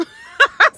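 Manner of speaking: sudden high loud burst of laughter; real loud laugh. Real, not acted. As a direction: crack up out loud. Laughs loudly after clip.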